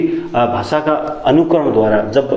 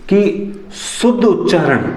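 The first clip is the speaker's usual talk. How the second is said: drawn-out and higher, dictating each syllable of शुद्ध उच्चारण; slow dictation; 5 semitones higher, slower than usual. Shown as a demonstration. Teacher dictating the next point to students, slow and drawn out. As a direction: spell out शुद्ध उच्चारण slowly while writing. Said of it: "अगला point मैं इसी पे लिख रहा हूँ".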